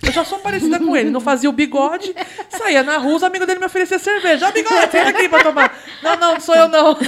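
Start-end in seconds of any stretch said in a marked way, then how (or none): 4.5-7.0 higher, louder laughing mimicry; laughing quote. Mocking the dad's friends and herself, laughing. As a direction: play both sides laughing.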